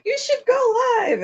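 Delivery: high mock imitation of a friend; squeaky, teasing take on her urging; Miss Southern Belle. Friend nudging her to stream, mimicked in a high voice. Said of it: "Miss Southern Belle is like".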